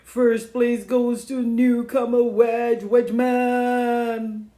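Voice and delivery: loud, slow booming announcement; grand slow announcer voice; thunderous announcer. Acted. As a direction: boom it like a stadium announcer. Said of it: "a thunderous voice making the dreaded announcement".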